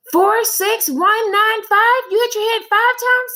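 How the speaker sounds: put-on high, loud protesting kid; child Joe. Boy insists to his teacher he counted right, high and indignant.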